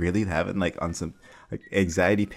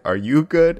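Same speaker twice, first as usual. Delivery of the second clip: a sudden high, loud "are you good?"; alarmed shout; 10 semitones higher, 10 dB louder than usual. Retold or imitated. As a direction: yell it out in a startled panic, higher and louder. Jumps up, scared, checks on friend.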